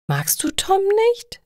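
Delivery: surprised question, pitch leaping high. A narrator's reading. Theresa asks her friend about Tom, surprised. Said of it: "schaut Lena überrascht an".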